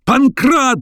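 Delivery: very high, urgent call; frantic repeated shout. A narrator's reading. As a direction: yell the name in high exasperation. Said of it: "повторил профессор".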